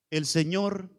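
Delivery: firm pulpit declaration; forceful preaching. Real, not acted. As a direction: urge the church with weight.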